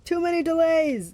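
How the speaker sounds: high, forceful monster bellow. A narrator's reading. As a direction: bellow it with impatience. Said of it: "the monster bellowed".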